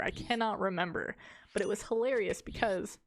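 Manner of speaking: ordinary talk, nothing marked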